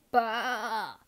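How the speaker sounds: bleating like a sheep; sheep cry. A narrator's reading. Pete the sheep cries out an idea, a bleating bah. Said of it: "cried Pete the sheep".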